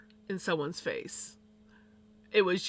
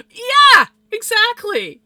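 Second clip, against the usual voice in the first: much higher, very loud burst; loud agreement; 14 semitones higher, 16 dB louder, much slower than usual. Real, not acted. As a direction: shout it out in emphatic agreement. Sudden loud agreement mid-story.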